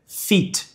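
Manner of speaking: "feet" with a tense ee vowel; long-i pronunciation. Shown as a demonstration. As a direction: say "feet" with Portuguese i. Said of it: "Letra E".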